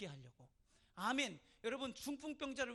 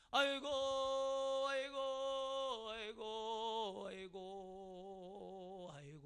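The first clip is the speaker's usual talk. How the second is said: hired mourner's wail; 4 dB louder, much slower than usual. Acted. Paid mourner at Lazarus's wake, keening loudly then fading. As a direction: draw out a mock lament on one wavering breath. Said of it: "돈 받고 곡해주는 사람이 있어".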